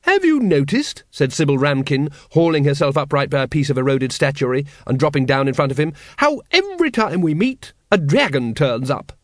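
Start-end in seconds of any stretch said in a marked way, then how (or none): 0.0-1.0 high, slow lady's voice; hearty, drawn-out opening to a remark; Lady Ramkin. Acted. Sybil Ramkin addresses Vimes. 6.2-9.1 high, lilting lady's voice; Lady Ramkin. Acted. Sybil Ramkin addresses Vimes.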